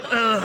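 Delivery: a loud, high ugh of revulsion; disgusted groan. Retold or imitated. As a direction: recoil in disgust. Disgust at roach legs.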